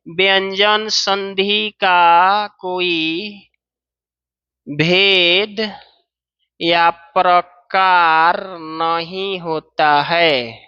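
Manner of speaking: whole rule said very slowly, spaced out; slow dictation emphasis. Shown as a demonstration. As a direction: dictate each word slowly for notes. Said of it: "teacher stressing key rule".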